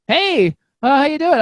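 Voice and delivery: high-pitched imitated greeting; sudden friendly greeting. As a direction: pipe up as the woman did. Voices woman's sudden friendly greeting.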